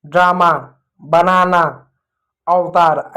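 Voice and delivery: ड्रामा, बनाना, अवतार singly, with long open आ; open आ sound. Shown as a demonstration. Teacher listing open-syllable words, long आ in ड्रामा, बनाना, अवतार. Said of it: "आ का ध्वनि आता है".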